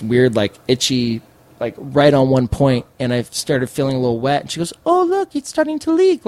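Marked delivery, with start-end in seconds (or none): 4.8-6.3 imitating the healer, very high and matter-of-fact; casual mock female healer. Healer noticing the lump leaking to her client, casual high mock voice. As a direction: lightly remark it in a high-pitched put-on voice. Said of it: "like, all normal".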